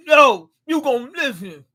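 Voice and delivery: mock tough insistence; forceful command. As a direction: insist he will listen. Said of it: "Yuji got him, headbutt him".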